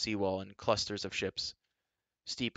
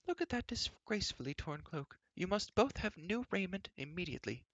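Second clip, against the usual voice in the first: high, disapproving queen voice; tut-tut at the girl's state; fussy queen; 10 semitones higher, 3 dB quieter than usual. A narrator's reading. Queen fusses over clothes.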